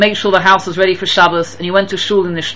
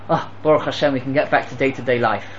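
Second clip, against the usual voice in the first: mock relieved sigh; 7 semitones lower, 7 dB quieter than usual. Mocking relief at returning to normal.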